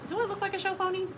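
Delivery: high-pitched, incredulous question; indignant retort. Acted. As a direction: ask it high, as if affronted.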